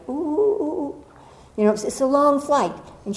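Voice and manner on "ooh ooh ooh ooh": high, nervous little oohs. Retold or imitated. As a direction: fret in a small high voice. Tiny woman nervous beside him.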